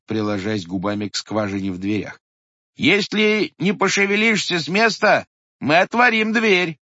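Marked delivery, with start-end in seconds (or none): 2.8-6.8 high, loud warning through the door; threatening call. A narrator's reading. Clerk threatening the thing behind the door, loud. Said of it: "продолжал писарь".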